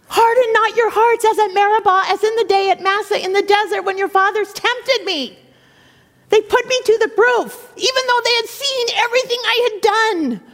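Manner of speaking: very high, urgent psalm reading; God's high, wounded rebuke; impassioned proclamation. Acted. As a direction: cry it out from the pulpit.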